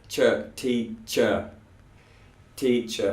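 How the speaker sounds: "teacher" with a crisp ch; model ch sound. Shown as a demonstration. Teacher drilling the ch sound for students. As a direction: isolate ch then say "teacher" clearly. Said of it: "So we've got ch".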